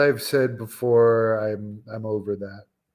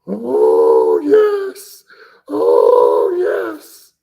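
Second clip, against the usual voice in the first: loud, high wailing ghoulish cry; Paul Bear; 7 semitones higher, 10 dB louder, much slower than usual. Acted. Streamer tries a wrestling manager's catchphrase, loud eerie wail. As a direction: wail it out in a strained falsetto, attempting an impression. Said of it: "I can't do a Paul Bear impression".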